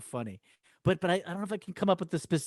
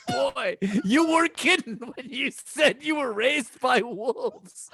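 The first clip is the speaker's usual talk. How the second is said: retold through laughter, much higher and slow; laughing quote; 9 semitones higher, slower than usual. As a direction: replay the silly line while cracking up. Retelling partner's funny entrance line.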